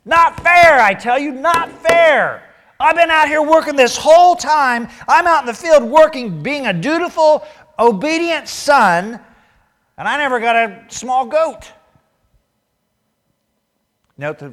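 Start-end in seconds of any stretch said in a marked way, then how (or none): 0.1-11.7 high, loud, whining "not fair"; whiny resentful son. Acted. Older son sulking about the party, high and whiny. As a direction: whine it like a sulking older brother.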